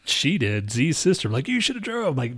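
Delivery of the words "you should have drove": high-pitched mocking accusation; mock accusing whine; mimicked blame. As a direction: parrot the sister's complaint in a higher voice. Mimics sister's blaming complaint.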